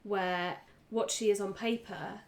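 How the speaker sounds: usual speaking voice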